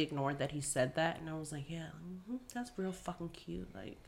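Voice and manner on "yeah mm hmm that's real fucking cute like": very soft sarcastic approval; retold cold dismissal under the voice; quietly mad. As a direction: brush him off quietly, seething. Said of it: "I was already so fucking mad".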